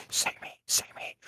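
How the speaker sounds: imitating a secret whispered plea; whispering. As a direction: whisper it like a covert prompt to a partner. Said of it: "you're just whispering".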